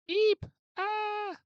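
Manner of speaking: very high startled eep; tiny frightened squeal; mock squeak.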